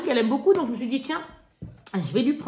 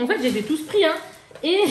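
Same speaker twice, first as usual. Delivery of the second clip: ordinary talk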